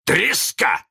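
high, loud one-word taunt; taunting captain mimicry. A narrator's reading. Peter mocks Hook, high loud taunt. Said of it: "ответил голос".

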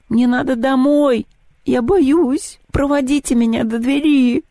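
high, slow, frightened wail; panicked pleading. A narrator's reading. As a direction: beg to go home in a panic. Испуганная Маша умоляет. (Маша в панике просится домой)

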